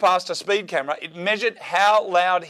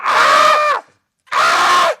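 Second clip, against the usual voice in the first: shriek; 8 dB louder than usual. Pretend. Mock fright at puppet mouth.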